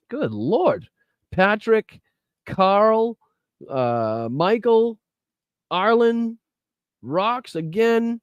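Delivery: high-pitched, drawn-out donor names; names sung out high in disbelief; amazed roll call. Real, not acted. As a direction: call each name high, amazed at the pile-up.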